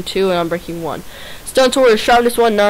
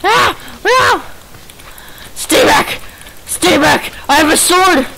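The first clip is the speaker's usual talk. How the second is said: panicked yelling; 7 semitones higher, slower than usual. Real, not acted. Backing away from a skeleton.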